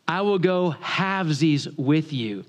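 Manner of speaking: slow, word-by-word repeat; very slowly. Retold or imitated. Country boy repeats for a Yankee, very slowly. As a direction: spell it out slowly, each word apart. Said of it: "say that again very slowly".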